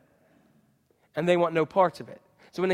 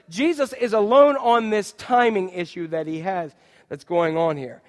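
ordinary talk

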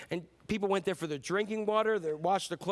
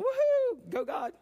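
high excited woohoo; imitating an excited fan in the stands; fan cheer; 5 dB louder, slower than usual. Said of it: "You're in the stands going".

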